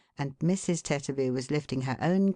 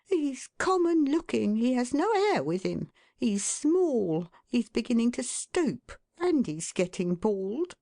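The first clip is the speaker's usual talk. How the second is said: a sulky wife grumbling to herself, pitched up; muttering wife; 9 semitones higher than usual. A narrator's reading. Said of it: "muttered Missus Tetterby".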